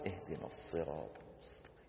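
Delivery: a near-silent recital with hissing S sounds; whispered silent prayer recitation. Shown as a demonstration. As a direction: recite the prayer under the breath, letting the ص in الصراط hiss. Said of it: "بتحس إنه شو عم يقول؟". (قراءة سرية بصفير الصاد)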